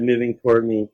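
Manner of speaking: plain reading voice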